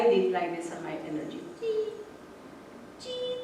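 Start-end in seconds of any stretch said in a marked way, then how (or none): 1.6-3.4 high, soft little chirp; bird chirp. Retold or imitated. Imitating the bird's call.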